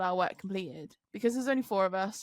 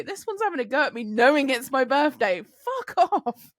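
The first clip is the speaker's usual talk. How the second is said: indignant; 5 semitones higher, 6 dB louder than usual. Retold or imitated. Retold fury at being berated.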